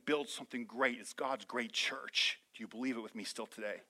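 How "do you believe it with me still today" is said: ordinary talk, nothing marked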